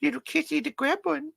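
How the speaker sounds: squeaky little critter voice. Pretend. Toy-voice aside.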